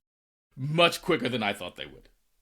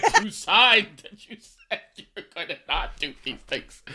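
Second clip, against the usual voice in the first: loud, raised, laughing reprimand; mock scolding; 7 semitones higher, 5 dB louder than usual. Reminding a friend of the trip rules, mock indignant. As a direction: lay down the law in mock outrage.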